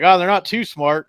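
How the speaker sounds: half-hearted yell to the workers on shore. Retold or imitated. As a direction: call it up the bank as a light tease. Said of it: "something he yelled up to those guys".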